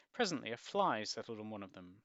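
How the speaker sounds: ordinary delivery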